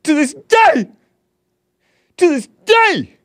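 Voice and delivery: a mock-fiery ranting preacher build; a rising, over-the-top rant that peaks on day; Deontay Wilder impression. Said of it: "in your best Deontay Wilder impression".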